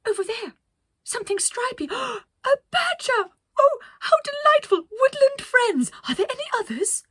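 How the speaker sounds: very high, loud delighted squeals; Penelope squealing at a squirrel to her crew; thrilled. Acted. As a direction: gush over the little squirrel. Penelope thrilled by woodland animals.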